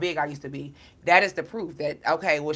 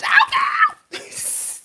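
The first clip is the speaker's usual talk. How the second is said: piercing high burst of laughter; shrieking laugh; 6 dB louder than usual. Real, not acted. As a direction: let out a squealing laugh. Laughing at her own joke.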